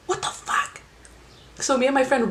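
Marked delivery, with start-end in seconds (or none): 0.1-0.8 breathless whispered disbelief; shocked whisper. Retold or imitated. Whispering shocked at the gun in his shorts. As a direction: whisper it like you just saw a gun.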